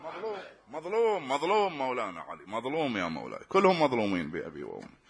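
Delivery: soft, low grieving repetition; preacher lamenting Ali in a hushed voice; mournful lament. Acted. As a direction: mourn quietly over the wronged imam.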